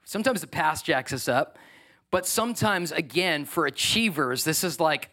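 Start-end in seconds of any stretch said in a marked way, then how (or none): none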